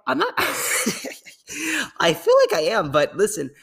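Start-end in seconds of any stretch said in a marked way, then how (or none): 0.4-1.1 laugh. Real, not acted. Laughing at being called traitor.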